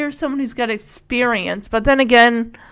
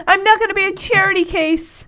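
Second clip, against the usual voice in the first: higher-pitched wounded pride, lightly acted; mock indignation; 7 semitones higher than usual. Broke mother refuses her family's money, mock indignation. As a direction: imitate a proud woman refusing handouts.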